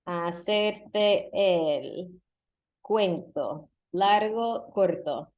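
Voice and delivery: "hacerte el cuento largo corto" drawn out very slowly, word by word; slow demo. Shown as a demonstration. Teacher to students, first take, very slow and deliberate. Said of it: "Any guesses?".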